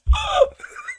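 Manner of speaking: very high mock gasp; hammed-up gasp; dramatic gasp. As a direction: gasp like a shocked fan. Dramatic gasp after bit.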